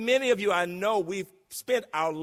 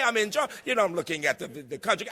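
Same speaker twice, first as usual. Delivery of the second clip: ordinary talk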